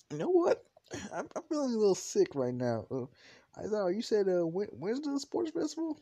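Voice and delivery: high-pitched, stammering bluff; flustered excuse. Acted. Student making excuses to his teacher, flustered.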